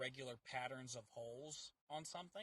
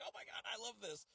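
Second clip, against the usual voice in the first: gushing; 7 semitones higher, 4 dB quieter than usual. Retold or imitated. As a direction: re-enact a delighted fan, lightly. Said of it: "we were gushing about it".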